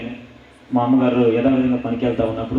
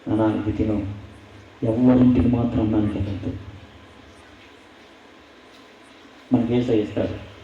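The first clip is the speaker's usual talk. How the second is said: lower, softer, gently coaxing; tender mother; 4 semitones lower, 3 dB quieter than usual. Retold or imitated. Mother urging her son to eat, soft and tender. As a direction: soothe the child like a poor mother.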